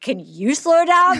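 slow, drawn-out mocking echo; mock slow repeat. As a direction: slowly repeat the request back, teasing. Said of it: "And I'm like".

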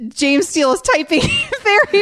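high-pitched, breaking up mid-sentence; laughing. Real, not acted. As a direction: try to keep announcing through real laughter. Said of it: "Why couldn't you get through without laughing?".

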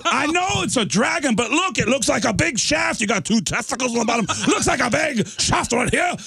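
high-pitched, worked-up argument; exasperated insisting. Retold or imitated. Singer arguing with drummer over the dragon, exasperated insisting. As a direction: insist in a high, hammed-up holler.

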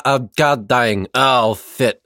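talking with tongue sticking out; words slurred by a tongue hanging out; tongue half out. Real, not acted. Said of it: "talk with my tongue half sticking out".

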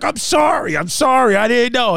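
high, rushed apology; flustered dad. Retold or imitated. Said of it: "He's like".

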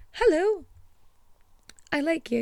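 high, giddy gushing; gushing fan. Retold or imitated. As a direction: squeal like a starstruck fan. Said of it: "And I'm just like".

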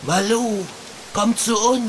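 false lure voice. Acted. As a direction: coax her like a trickster. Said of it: "Was für eine miese Kopie". (hohe, lockende Trugstimme)

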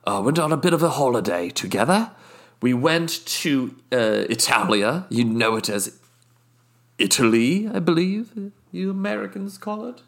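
haughty British guest, smug and unhurried; a snooty Brit savouring every indiscretion; posh British accent. Acted. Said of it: "my British accent slips".

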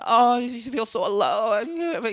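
slow, mopey imitation of an emo song line; mock emo singer. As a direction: drag it out like a self-pitying emo lyric. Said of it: "because it's all like".

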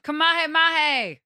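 high, loud, slow anime battle cry; anime attack shout. Retold or imitated. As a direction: stretch it out like a cartoon fighter's attack.

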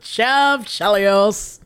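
savoring name. Pretend.

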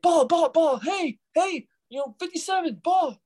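imitating kids calling out from the stands; shouting kids. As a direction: mimic kids hollering for a ball. Kids yelling for balls, mimicked.

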